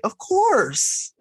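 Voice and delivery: put-on very high, sweet little-boy voice; high, eager child. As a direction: imitate a polite little kid. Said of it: "he was like".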